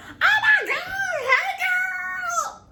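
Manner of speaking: high shrieking greeting, mocking a loud girl; loud excited girl. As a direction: imitate a girl shrieking hello on the bus. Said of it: "She said".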